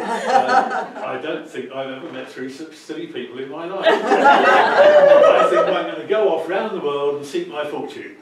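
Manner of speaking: fighting laughter, loud; laughing through it. Real, not acted. Storyteller laughing while voicing the young man's farewell.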